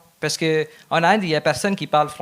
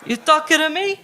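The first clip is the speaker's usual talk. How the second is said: mock De Niro; 9 semitones higher, faster than usual. De Niro Taxi Driver quote on stage.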